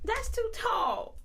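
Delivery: high-pitched protest. Real, not acted.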